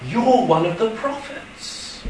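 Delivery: ordinary delivery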